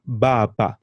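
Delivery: "baba" with open ah vowels, bah-bah; Swahili a vowel. Shown as a demonstration. Teacher modelling Swahili "father" for learner, open vowels. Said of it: "Can you try and pronounce that?".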